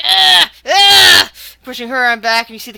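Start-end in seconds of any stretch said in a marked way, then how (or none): 0.0-1.3 loud acted straining groans, pitched high; straining grunt. Arm wrestler rallying back, loud straining grunts. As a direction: strain out two big grunts. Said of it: "comes rallying back and is like".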